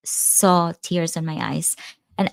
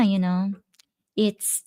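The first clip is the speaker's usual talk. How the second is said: normal talking voice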